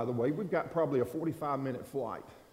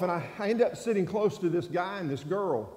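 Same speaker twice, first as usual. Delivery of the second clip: ordinary delivery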